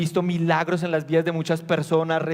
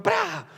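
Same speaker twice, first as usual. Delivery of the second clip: mock downpour burst; 12 semitones higher than usual. Sudden downpour sound.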